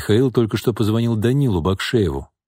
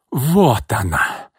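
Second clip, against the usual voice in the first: slow, higher stretched-out exclamation; exclaiming; 4 semitones higher, much slower than usual. A narrator's reading. Old woman greets her enemy's entrance, exclaiming. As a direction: announce her with scornful relish. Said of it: "воскликнула Тамара".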